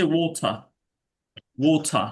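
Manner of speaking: water with a crisp British t, said wota; British-accent water; British water, no flap. Shown as a demonstration. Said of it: "ব্রিটিশে হচ্ছে".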